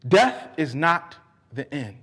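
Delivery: usual speaking voice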